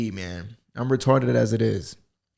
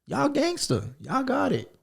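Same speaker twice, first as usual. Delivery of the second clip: mock high praise; 13 semitones higher, 5 dB quieter than usual.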